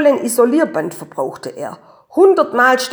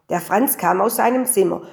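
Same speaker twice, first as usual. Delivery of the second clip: ordinary delivery, nothing marked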